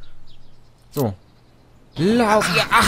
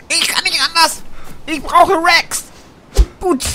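much higher, louder comic squeal; mock-desperate, squeaky repeat; high put-on voice; 11 semitones higher, 3 dB louder, slower than usual. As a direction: plead it in a silly high voice.

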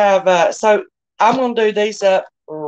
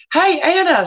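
high, eager hello; light up as a friend arrives; excited greeting; 10 semitones higher, 3 dB louder than usual. Real, not acted. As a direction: glad hello.

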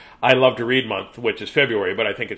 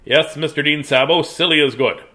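ordinary delivery

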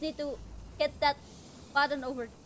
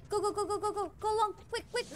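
higher-pitched rapid-fire repeats; urgent urging; 4 semitones higher, faster than usual. Real, not acted. Player urging the character on past danger, urgent. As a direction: hurry them along urgently.

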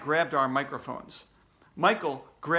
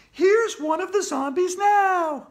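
very high, loud, drawn-out announcer; Andrea, keen reporter; 8 dB louder, slower than usual. Acted. Andrea spotting a zombie on camera, excited reporter.

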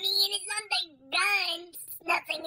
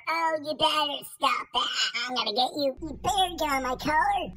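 put-on low old man threats; 6 semitones lower than usual.

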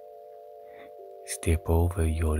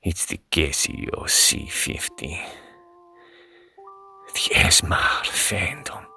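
spooky whisper. Pretend. Phantom reveal.

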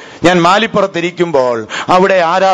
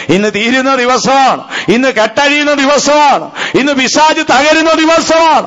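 each phrase higher, building to a proclamation; climactic preaching; 9 semitones higher than usual. Acted. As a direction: build the declaration step by step. Declaring victory over Satan. (പടിപടിയായി ഉയരുന്ന പ്രഖ്യാപനം)

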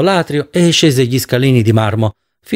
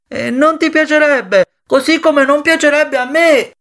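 high-pitched, sustained calling voice; Jory calling after him; 8 semitones higher than usual. A narrator's reading. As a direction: keep calling out high, climbing toward the end. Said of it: "gli urlò dietro Jory". (voce acuta che grida dietro a qualcuno)

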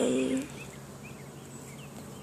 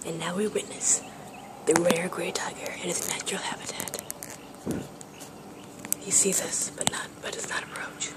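nature documentary; 2 semitones lower, 6 dB quieter than usual. Pretend. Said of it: "I don't know what I'm doing".

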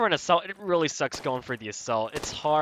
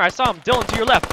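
higher, louder urgent callout; shouted callout; 7 semitones higher, 6 dB louder than usual. Real, not acted. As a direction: yell the warning to a teammate. Callout in firefight.

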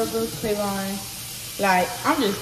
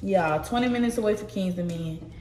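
ordinary delivery

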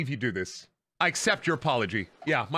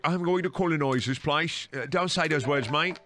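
hammed-up British accent; mock British accent. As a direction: parody a British speaker. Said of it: "British people really do be like".

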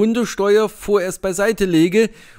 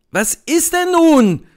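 higher, louder, slow call; 9 semitones higher, 4 dB louder, slower than usual. A narrator's reading. Narrator calling across to the idle officer, impatient. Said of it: "rufe ich ihm zu".

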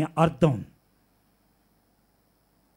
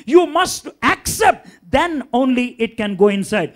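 emphatic preaching; much slower than usual. Acted.

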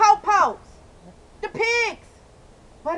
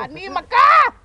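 desperate yell; 6 semitones higher, 10 dB louder than usual. Real, not acted. Repeated plea.

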